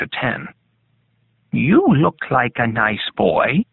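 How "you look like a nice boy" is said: put-on slow, coaxing old lady, lilting up at the end; little old lady voice. A narrator's reading. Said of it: "a convincing little old lady voice".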